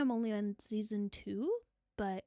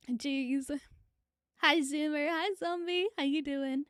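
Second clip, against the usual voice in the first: normal talking voice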